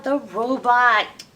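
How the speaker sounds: ordinary delivery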